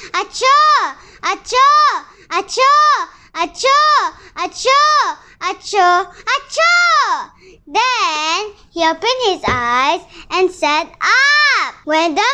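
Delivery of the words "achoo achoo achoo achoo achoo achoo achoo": big sneezes. Acted. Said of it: "The little boy sneezed seven times".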